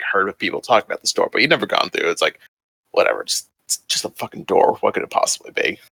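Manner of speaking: ordinary talk, nothing marked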